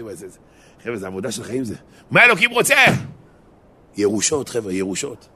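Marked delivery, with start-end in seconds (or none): 2.1-2.7 high, loud rhetorical question; emphatic outcry. Real, not acted. Preacher driving the question home, emphatic outcry. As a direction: call it out forcefully.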